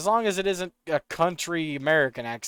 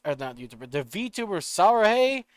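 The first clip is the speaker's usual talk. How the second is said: usual speaking voice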